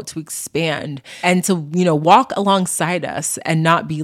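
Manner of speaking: ordinary talk, nothing marked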